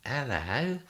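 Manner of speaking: small woman greeting. Retold or imitated. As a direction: peer up at a tall man. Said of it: "she goes".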